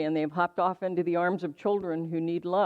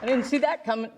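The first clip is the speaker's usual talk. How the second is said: surprised; 6 semitones higher, faster than usual. Real, not acted. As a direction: genuinely caught off guard, higher. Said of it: "You got me".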